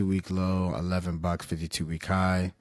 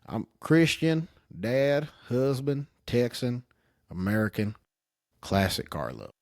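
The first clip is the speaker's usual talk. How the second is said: country accent; 2 semitones higher than usual. Shown as a demonstration. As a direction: lay on a Southern twang. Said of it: "let me turn my, uh, country accent".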